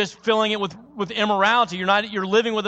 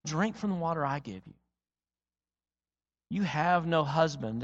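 tender; 2 semitones lower, 6 dB quieter than usual. Acted. Jesus's offer, soft.

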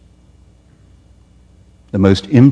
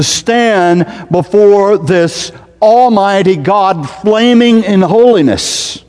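high, loud, impassioned preaching, slowing at the end; preacher at full force, much higher and louder; 10 semitones higher, 3 dB louder than usual. Acted. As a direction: thunder it from the pulpit, stretching the last words.

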